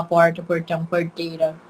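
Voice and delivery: "porta, portão, porteira" with retroflex caipira R. Shown as a demonstration. Teacher showing what Acre doesn't say, caipira R. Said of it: "que nem caipira".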